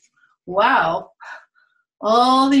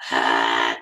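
much lower booming sneeze; a deep bear sneeze. Acted. Repeats the bear's sneeze.

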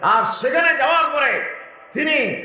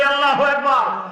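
much higher rallying cry; louder takbir call; 5 semitones higher than usual. Shown as a demonstration. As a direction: raise the pitch sharply to rouse the crowd. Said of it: "আরো জোরে বলি". (আরো জোরে, চড়া গলায় তাকবির ধ্বনি)

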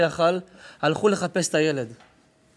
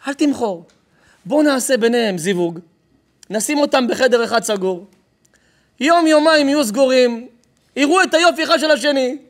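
raised, increasingly loud sales pitch; eager scheming; 7 semitones higher, 7 dB louder than usual. Acted. One kidnapper pitching a match to the other, eager and rising. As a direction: build excitement as you sell the plan.

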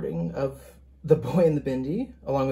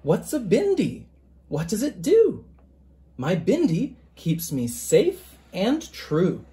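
gentle picture-book reading, even and measured; story narration; 3 semitones higher than usual. A narrator's reading. Author reading his picture book to listeners, storytelling voice. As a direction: read it aloud to a class of children.

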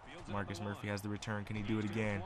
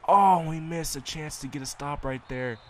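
loud, higher burst of frustration at the missed stop; frustrated shout; 6 semitones higher, 12 dB louder than usual. Real, not acted. Gamer yelling at the screen over a missed tackle, loud and frustrated. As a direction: yell it out like you just blew the play.

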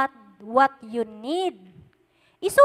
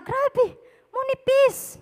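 awed, emotional high cry; 11 semitones higher, slower than usual. Real, not acted. Preacher marveling at God's peace, high and moved. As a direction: voice jumps high in wonder at peace.

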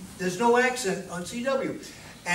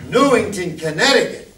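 "Newington, Connecticut" said loud and deliberate, each word hit; emphasize Newington; 8 dB louder than usual. Shown as a demonstration. Operator stressing the station's home town on air, loud emphasis. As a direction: punch out "Newington, Connecticut" with pride. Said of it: "and then you emphasize with".

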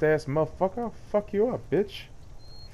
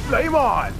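battle cry; 8 semitones higher than usual. Pretend. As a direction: yell it as a hero charging in. Casting fire spell.

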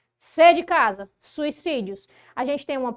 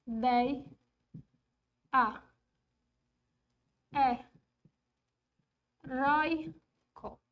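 isolated syllables, long gaps between them; slow syllable breakdown; 4 dB quieter, much slower than usual. Shown as a demonstration. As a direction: dictate each syllable as you write it. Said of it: "Deixa eu separar todas essas sílabas".

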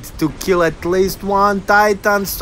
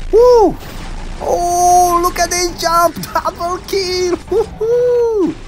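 excited whooping; 8 semitones higher, slower than usual. Real, not acted. Gamer celebrating a jump and double kill, elated whooping. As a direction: whoop and cheer in a high voice.

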